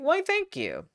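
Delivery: high, theatrical cat voice; mystic cat voice. Pretend. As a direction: play the smug mystic cat. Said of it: "Sounds like actual voice acting".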